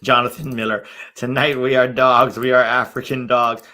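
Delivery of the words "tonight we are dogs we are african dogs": loud, spoken mock rallying cry; mock coach quote. Streamer quoting the coach's pep talk, loud and mocking. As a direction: belt out the coach's motto, spoken. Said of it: "Somebody should, uh, auto-tune that".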